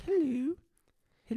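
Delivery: mimicking the French student Marie, high French accent; mock French girl. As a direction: coo hello like a shy French exchange student. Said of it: "Dude, her voice".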